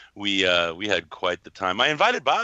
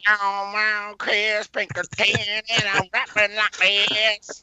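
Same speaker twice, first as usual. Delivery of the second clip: mock a friend's rap voice, pitched way up and stretched slow; Pinkerton; 13 semitones higher, 4 dB louder, much slower than usual. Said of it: "He, like, gets this voice like".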